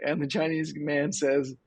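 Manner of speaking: ordinary delivery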